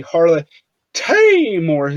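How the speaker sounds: "Harley T Morris" with a big, drawn-out "T"; emphasis on T. Retold or imitated. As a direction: lean hard and slow on the "T" in "Harley T Morris". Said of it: "put a lot of emphasis on the T".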